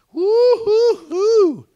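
high, loud, stretched joyful woo-hoo; slow, high, loud cheer of joy; happy whoop. Real, not acted. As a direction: whoop with delight. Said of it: "I'm just getting so happy".

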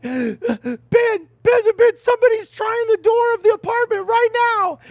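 high, loud panicked plea; breathless, frantic cry for help on the phone; frantic woman. Retold or imitated. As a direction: imitate a terrified caller, voice climbing high. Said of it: "I hear this frantic woman".

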